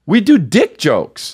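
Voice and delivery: slow mock-proud. Joking about their job.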